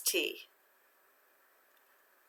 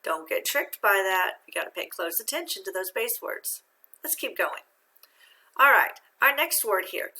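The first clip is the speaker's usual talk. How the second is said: ordinary delivery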